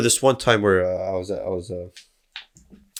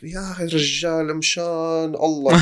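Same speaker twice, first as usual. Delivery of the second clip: higher, slower fed-up plea; higher exasperated mock plea; exasperated retort; 7 semitones higher, slower than usual. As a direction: beg to be left alone. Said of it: "I'm like".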